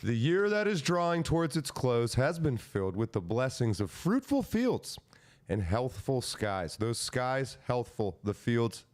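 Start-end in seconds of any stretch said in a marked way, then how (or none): 0.0-6.8 high, grand oratory with drawn-out endings; proclamation reading. Acted. Reading Lincoln's proclamation to co-hosts, grandly declaimed. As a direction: declaim it like a statesman's address.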